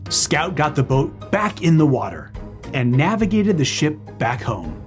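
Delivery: ordinary delivery, nothing marked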